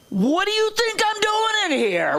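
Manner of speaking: high, exasperated comeback; indignant retort. Retold or imitated. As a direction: snap back in mock outrage. Mocking rude store clerks.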